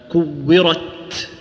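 very slow "كورت" with the sound stopped on ت, then breath let out; drawn-out tajweed demo of "كورت"; held, then released ت. Shown as a demonstration. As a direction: end "كورت" on a long closure and a puff of air. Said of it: "بتلاحظ أن الصوت انحبس أول شيء،".

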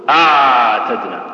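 "أعتدنا" drawn out slowly with the ع left flowing; ain stretched out. Shown as a demonstration. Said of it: "تخليه يكون رخو".

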